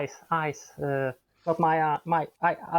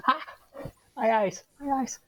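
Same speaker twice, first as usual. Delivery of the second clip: acted pain; 11 semitones higher than usual. Acts a character with injured eyes.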